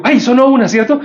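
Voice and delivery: high-pitched, eager retold question; acting out Jorge. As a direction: pitch it up, checking with someone. Acts out startled Jorge.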